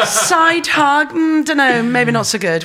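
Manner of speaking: high, loud, giggly uncertainty; laughing doubt. Real, not acted. Guest laughing at the koala side-hug idea. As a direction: laugh it off, unconvinced.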